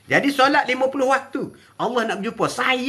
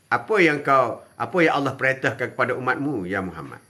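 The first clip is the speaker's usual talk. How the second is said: Prophet Musa's questioning voice; 8 semitones lower than usual. Acted. Preacher voicing Musa asking Muhammad about the command, low and grave. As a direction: drop the voice low and ask gravely.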